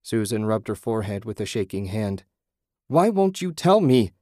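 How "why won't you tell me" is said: high, loud desperate shout. A narrator's reading. Susan shouting at the voice demanding answers. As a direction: yell it in frustration. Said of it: "she shouted".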